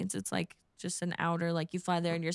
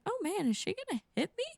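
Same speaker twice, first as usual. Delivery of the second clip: mild fear; 11 semitones higher, faster than usual. Retold or imitated. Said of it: "for a second".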